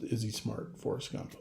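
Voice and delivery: hushed quote. Retold or imitated. Quoting Forrest Gump's question.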